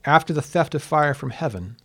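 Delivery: measured, declaimed verse with long pauses between phrases; stately poem recital, line by line; poetry reading. Acted. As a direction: recite an ancient ode in translation, lifting the start of each phrase. Reads own translation of the ode.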